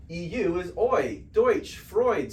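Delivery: Deutsch and Freud said with oi, like doytch and froyd; slow, clear oi in Deutsch, Freud; German eu sound. Shown as a demonstration. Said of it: "E U is pronounced Oi".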